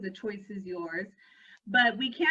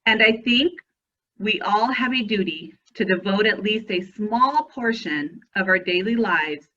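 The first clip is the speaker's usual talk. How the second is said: lower, louder, measured memorial reading; solemn quote reading; 3 semitones lower, 9 dB louder than usual. Acted. Reads a remembrance quote.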